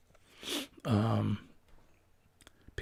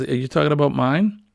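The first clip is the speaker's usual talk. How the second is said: ordinary talk, nothing marked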